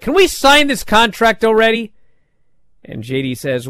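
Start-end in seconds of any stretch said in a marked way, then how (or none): 0.0-1.9 impatient demand. Retold or imitated. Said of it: "Bron Breakker's like".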